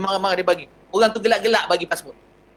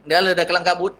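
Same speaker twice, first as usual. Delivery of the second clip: ordinary delivery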